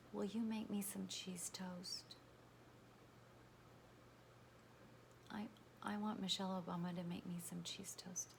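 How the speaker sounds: breathy confiding whisper. Real, not acted. Confessing her secret wish to followers in a whisper.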